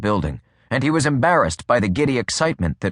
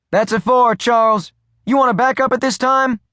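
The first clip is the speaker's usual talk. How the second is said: much higher dispatcher voice; woman dispatcher; 13 semitones higher, 4 dB louder than usual. A narrator's reading. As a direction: answer briskly as the radio operator. Dispatcher replies.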